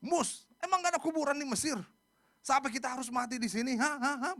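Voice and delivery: high, loud acted complaint; in-your-face confrontation. Israelites confronting Musa in the desert, angry challenge. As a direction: hurl mock-angry questions right into the face. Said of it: "Ngomongnya in your face, depan muka".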